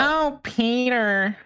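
slow, drawn-out sultry Oh, Peter; Lois voice. Pretend. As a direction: stretch it out like a stripper. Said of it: "you sound like, um, a stripper".

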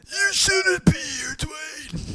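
high, slow eerie underbite voice; tethered voice. Acted. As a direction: jaw out, teeth bared, creepy and slow. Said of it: "say it in the tethered voice".

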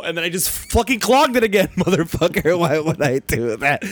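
loud ranting at yourself; loud, worked-up self-reproach; exasperated outburst. Real, not acted. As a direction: shout it in comic fury. Rants at own stupidity.

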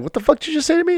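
high, sharp confrontational challenge; angry challenge. Retold or imitated. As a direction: snap back at the insult. Confronting the racist classmate.

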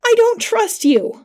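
high, drawn-out distressed protest; panicked cry. A narrator's reading. Attacker grabbing the knife, high panicked cry.

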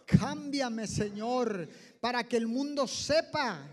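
earnest prayer, phrase by phrase; led prayer. Acted. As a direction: lead the church in fervent prayer. Leads congregation in prayer. (oración guiada y ferviente)

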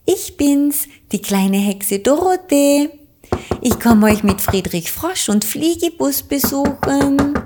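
hohe, laute Kinderstimme durch die Tür; laut rufend. A narrator's reading. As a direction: ruf laut und fröhlich als kleine Hexe. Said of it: "rief sie laut". (kleine Hexe ruft laut und hoch)

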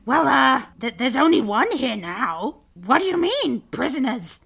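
lightly voiced, baffled goblin; a hint of bewildered guard; confused guard. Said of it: "looks confused and says".

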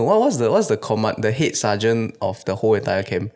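ordinary talk

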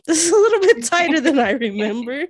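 high laughing dismay; laughing surprise. Retold or imitated. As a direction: laugh through the shock. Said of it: "I was like".

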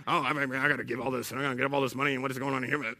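low, soft mock muttering; grumbling complaint. God's employee asked to give money, grumbling. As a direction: mutter a run-on gripe under your breath. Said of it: "constant grumbling and murmuring, complaining".

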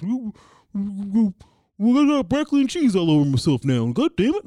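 exasperated guy covered in food; Mike voice. Retold or imitated. Mike complaining about broccoli on himself, loud. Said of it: "the kind of guy that's gonna".

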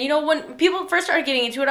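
normal talking voice

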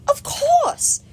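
very high delighted goddess; Aphrodite. A narrator's reading. Aphrodite gushing.

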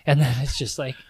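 ordinary talk

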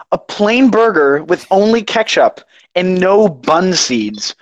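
"plain burger" slow, each word weighted; slow build, dropping on "seeds"; incredulous emphasis. Retold or imitated. Worker revealing fifteen-minute order, slow incredulous "no bun seeds".

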